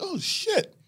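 hushed disbelief at your own tape; stunned realization. Retold or imitated. Comic watching his own tapes, stunned and high. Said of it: "I was like".

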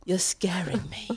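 hushed imitation of a frightened plea; soft, breath-only mimicry; mock scared whisper.

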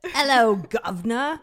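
a deliberately bad Eliza Doolittle cockney for a laugh. A podcast host mocks her Australian friend with hammed-up cockney. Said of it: "our deranged impressions of Tat".

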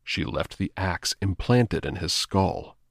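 usual speaking voice

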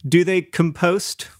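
compost drawn out as kum-POST; teasing mispronunciation. Retold or imitated. Returning to the question to tease co-host, compost stressed on the second syllable. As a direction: slowly land on POST in compost.

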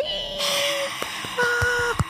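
high excited gasp. Acted.

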